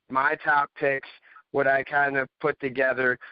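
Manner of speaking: ordinary talk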